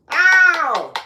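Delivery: high, very loud "ow!" holler; celebratory whoop. Real, not acted. Host hailing viewer Coco as queen, whooping loudly.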